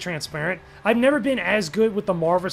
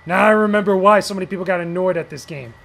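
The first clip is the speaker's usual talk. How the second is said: ordinary talk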